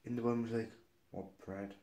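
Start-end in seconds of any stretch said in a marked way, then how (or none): 1.1-1.8 low, very soft mumble; imitated rude grunt. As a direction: mimic a server who barely bothers to speak. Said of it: "she was, like, grunting at me".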